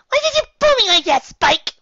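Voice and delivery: very high, loud filly voice; upset little sister; agitated Sweetie. Acted. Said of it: "she whispered to him in agitation".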